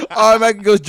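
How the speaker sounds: ordinary talk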